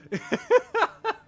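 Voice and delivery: high giddy cackle; a burst of manic laughter. Acted.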